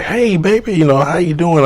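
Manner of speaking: high, sing-song Southern mom greeting; Southern talk. Retold or imitated. Mom greeting her son's guest warmly, in a high imitated Southern accent. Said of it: "Southern talk".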